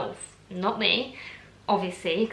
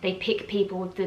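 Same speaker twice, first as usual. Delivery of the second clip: ordinary delivery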